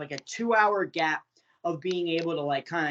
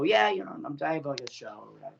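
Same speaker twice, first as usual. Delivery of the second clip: mock indifference; 2 semitones lower, faster than usual. Jaded wrestler answers why he's flying, indifferent. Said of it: "now I don't give a shit".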